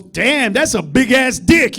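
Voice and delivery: loud, slow, impressed praise; impressed shout. Retold or imitated. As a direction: draw it out, truly impressed.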